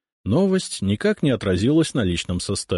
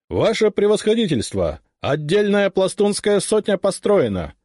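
shouting drill sergeant; 11 semitones higher than usual. Acted. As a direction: report at full voice across the square. Formal report to the ataman.

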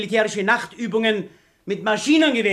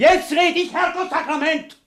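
angry; 8 semitones higher, 3 dB louder than usual. Acted. Shouting down opponents.

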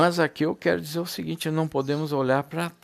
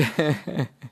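laugh. Real, not acted. Laughs while retelling brother's words.

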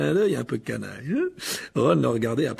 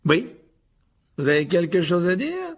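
smiling question; 4 semitones higher, 5 dB louder than usual. A narrator's reading. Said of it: "demanda-t-il à Seamus avec un sourire".

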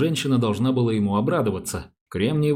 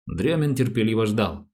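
plain reading voice

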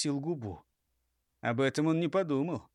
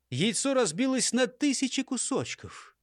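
plain reading voice

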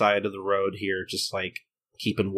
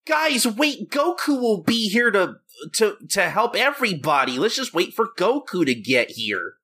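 mock Dragon Ball character; 9 semitones higher than usual. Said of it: "everybody's just like".

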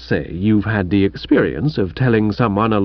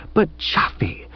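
meek whisper; 3 semitones higher, 5 dB quieter than usual. Acted. Bertie's meek interjection.

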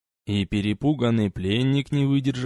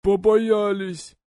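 high, slow, scared blurt; frightened admission; 10 semitones higher, much slower than usual. A narrator's reading. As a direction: admit it in terror. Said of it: "не стал врать пленный".

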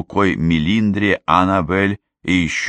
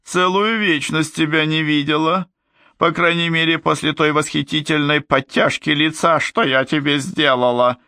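exclaiming; 10 semitones higher than usual. Acted. Glinda greets her sister in high, feigned delight.

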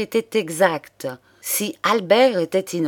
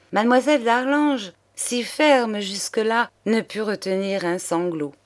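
ordinary delivery

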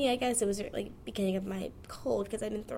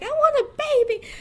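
much higher, louder mock-eager cry; excited squeal; 12 dB louder, faster than usual. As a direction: squeal it like a giddy future self. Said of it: "I'm like".

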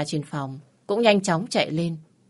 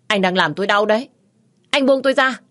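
rising, louder cry to be let go; pained protest; 5 semitones higher, 7 dB louder than usual. Acted. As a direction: push him off, hurt and angry. Wife struggling in husband's grip.

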